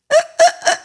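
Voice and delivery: a sudden squealing laugh; a quick high giggle at her own joke; high burst of laughter. Real, not acted. Laughs at crying likened to climbing.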